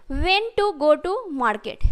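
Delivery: very slow, word by word; slow, careful model sentence; slow dictation. Shown as a demonstration. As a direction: spell it out slowly for the class. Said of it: "teacher models the English sentence".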